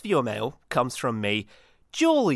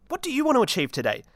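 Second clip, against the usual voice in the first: upbeat quiz question; 10 semitones higher, faster than usual. Acted. Reading out the day's question.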